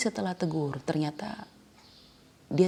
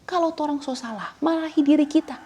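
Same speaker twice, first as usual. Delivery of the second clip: higher, louder exhortation; preacher pressing the congregation, loud and fervent; fervent preaching; 9 semitones higher, 5 dB louder than usual. Real, not acted. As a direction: build it up to a cry.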